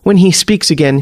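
ordinary delivery, nothing marked

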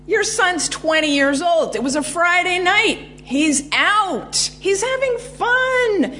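dismissive. Retold or imitated. Dismissive police brushing off father.

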